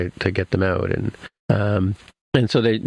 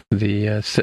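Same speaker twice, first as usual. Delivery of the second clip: ordinary talk, nothing marked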